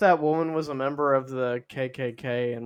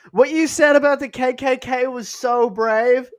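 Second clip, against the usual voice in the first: hammed-up admiring fan; gushing fan; 9 semitones higher, 5 dB louder than usual. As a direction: fan tells the star she saw his stream, gushing. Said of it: "she goes".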